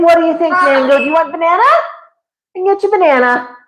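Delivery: high sing-song coaxing, as to a pet bird. Real, not acted. Owner offering Mango a banana, in a high coaxing voice.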